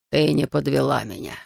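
put-on low character voice for an elderly man; old samurai's voice. A narrator's reading. Narrator voices old Daichi speaking.

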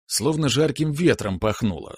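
ordinary delivery, nothing marked

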